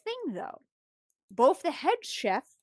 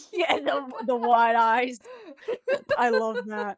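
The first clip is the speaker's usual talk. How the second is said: real laughing delight. Real, not acted. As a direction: talk through laughter. Amused by co-host's drawing.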